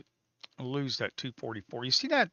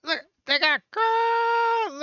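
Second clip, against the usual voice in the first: much higher and louder, squealing faster and faster; frantic outburst; 13 semitones higher, 8 dB louder than usual. Real, not acted. Trader to viewers as price crashes, shrieking. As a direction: shriek as it finally breaks. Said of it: "My dreams got crushed again".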